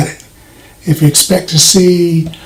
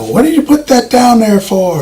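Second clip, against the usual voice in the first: joking yell; 6 semitones higher than usual. Retold or imitated. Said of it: "you are in fact yelling, ... as a joke".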